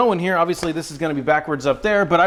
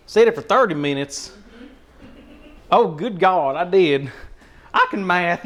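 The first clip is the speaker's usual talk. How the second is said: put-on Southern accent. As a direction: play a folksy North Carolina Southerner. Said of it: "this is the second accent".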